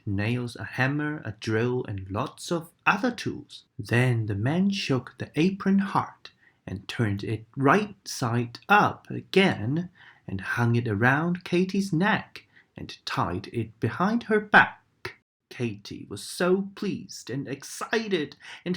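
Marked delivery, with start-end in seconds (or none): none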